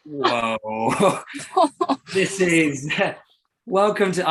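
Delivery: stunned laugh breaking through; laughing in disbelief. Retold or imitated. Teen driver stunned by boss's threat, laughing in disbelief. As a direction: laugh it off in shock.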